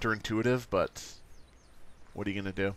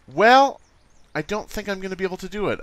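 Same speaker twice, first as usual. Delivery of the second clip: high exasperated; 8 semitones higher, 7 dB louder than usual. Pretend.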